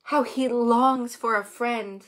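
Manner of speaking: usual speaking voice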